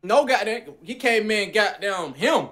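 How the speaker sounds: ordinary delivery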